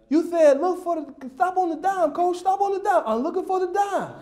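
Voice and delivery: put-on high, lisping child defending himself; toothless little kid. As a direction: explain earnestly like a gap-toothed four-year-old. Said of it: "so he sounded like this".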